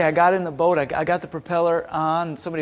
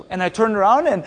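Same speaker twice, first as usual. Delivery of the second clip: ordinary talk, nothing marked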